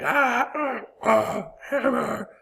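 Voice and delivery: high yelping cry of pain, hammed up; mock pain cry. A man smashes his finger with a hammer, high mock yelp. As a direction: yelp like a hammer just hit your finger.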